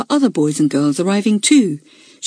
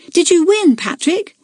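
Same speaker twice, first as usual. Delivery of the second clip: high, eager shout, held back in volume; shouted question; 10 semitones higher than usual. A narrator's reading. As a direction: call the question out in a raised pitch. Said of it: "she shouted".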